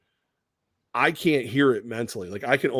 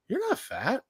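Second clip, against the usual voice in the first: much higher, softer woman's voice; imitated woman; 12 semitones higher, 5 dB quieter, slower than usual. Woman coming up to the host at events, high and surprised.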